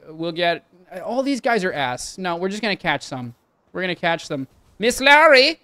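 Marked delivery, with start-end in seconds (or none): 4.8-5.6 put-on high, loud German-accented call; mock German accent. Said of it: "I don't know why she's German".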